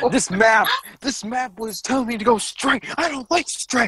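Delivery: mock outrage.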